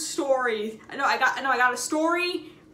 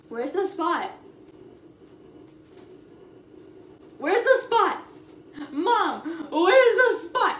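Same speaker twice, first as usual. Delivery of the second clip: put-on 1 building kid whine; 5 semitones higher, 5 dB louder than usual. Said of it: "he'd just be the whole time like".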